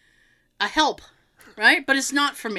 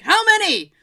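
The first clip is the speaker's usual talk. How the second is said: raised, higher question; called back; 9 semitones higher, 6 dB louder than usual. Retold or imitated. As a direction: shout the question back across the room. Calling back to therapist.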